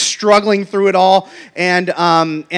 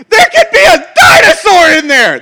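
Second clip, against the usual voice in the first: put-on high, loud, wailing child's cry, half laughing; Zeke's protest; 11 semitones higher, 8 dB louder than usual. Zeke protesting leaving the rock, high wailing hammed-up cry. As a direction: cry it out like an outraged little boy. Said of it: "Zeke was like".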